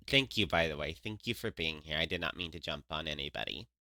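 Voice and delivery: soft and low, holding back tears; close to tears. Real, not acted. Thanking stream viewers, soft and near tears. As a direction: thank them gently while fighting tears. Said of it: "I'm gonna cry".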